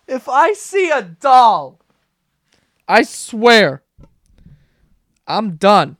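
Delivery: slow, loud ranting vow; yelling. Real, not acted. Host ranting about hated dolls, loud yelling. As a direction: belt it out with heavy emphasis. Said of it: "I've been yelling".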